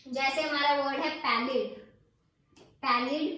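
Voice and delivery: normal talking voice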